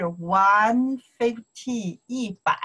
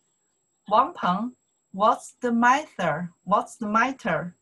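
slow, slightly raised teacher model; slow raised demo of the question; slow model line; 3 semitones higher, 3 dB louder than usual. Shown as a demonstration. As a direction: say it slowly for students to copy. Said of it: "Follow me to pronounce here".

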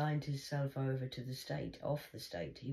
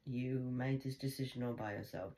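ordinary talk, nothing marked

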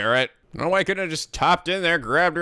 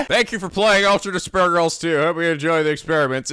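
ordinary delivery

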